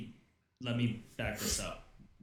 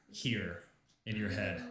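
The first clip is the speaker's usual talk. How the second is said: normal talking voice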